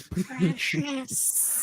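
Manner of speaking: high, soft Gollum murmur of precious. Pretend.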